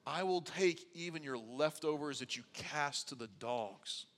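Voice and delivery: soft, subdued voicing of a plea; hushed, lowly retold plea; humble plea. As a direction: speak softly as a humble supplicant.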